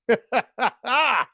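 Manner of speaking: put-on hearty old man chuckle; old man laugh. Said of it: "I hit her with the James Evans laugh".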